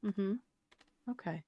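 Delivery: soft, even therapist voice. Pretend. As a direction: ask it like a calm counselor. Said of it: "in my therapist voice".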